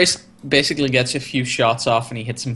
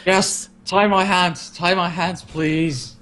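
higher-pitched mock pleading; hammed-up begging; 6 semitones higher, slower than usual. Co-host joins in as overeating Rigel, mock begging. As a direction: beg in playful, exaggerated desperation.

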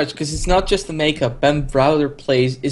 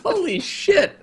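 very high stunned exclamation; surprised; slower than usual. Real, not acted. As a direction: still reeling, squeak it out. Still shocked at reveal.

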